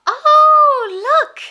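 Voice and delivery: very high, loud delighted cry. Pretend. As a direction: squeal with surprise at the pig. Delighted spotting a pig.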